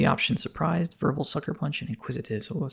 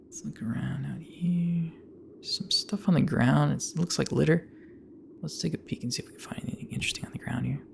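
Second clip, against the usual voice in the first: whispering. Real, not acted. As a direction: whisper it, like sneaking around. Player exploring game scene quietly.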